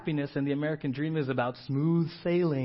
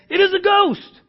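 high, loud cry of alarm; terrified cry; 14 dB louder than usual. Acted. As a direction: cry out in fear, far louder and higher. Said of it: "they were terrified and said".

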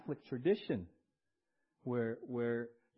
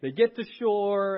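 lightly raised storytelling sermon voice; a louder, higher preaching narration; 6 semitones higher, 8 dB louder than usual. Acted. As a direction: tell the story with lifted energy. Storytelling the scene.

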